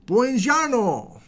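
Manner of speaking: slow, high, sung-out Italian "Buongiorno"; mock-Italian hello to an Italian viewer; Italian greeting. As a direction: stretch "Buongiorno" like an Italian host. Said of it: "Ah, Italiano".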